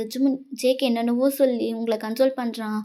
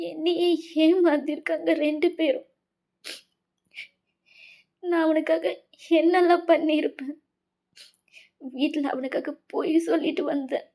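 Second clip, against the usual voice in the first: higher, tearful voice broken by sobs; heartbroken, crying junior; 4 semitones higher than usual. Acted. Wayan confiding to her senior how both betrayed her, sobbing. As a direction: sob out the betrayal haltingly.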